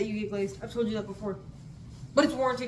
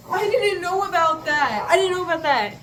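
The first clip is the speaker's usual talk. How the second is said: indignant disbelief; 7 semitones higher, 8 dB louder than usual. Real, not acted. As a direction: hammer it out in disbelief. Emphatic disbelief, repeated.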